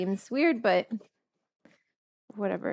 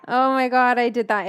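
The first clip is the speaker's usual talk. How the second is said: startled dismay; 3 semitones higher, 7 dB louder than usual. Real, not acted. Spills the oil.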